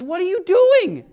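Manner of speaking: high, slow, half-laughing disbelief; incredulous. Retold or imitated. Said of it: "And Jesus says".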